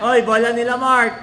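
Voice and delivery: loud call to teammate; shouting. Real, not acted. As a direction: yell the warning across court. Calling out possession to teammate. (malakas na sigaw)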